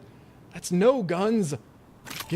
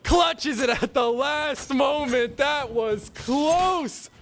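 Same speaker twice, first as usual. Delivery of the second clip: esports caster; 5 semitones higher, much slower than usual. Real, not acted. Clutch call settling.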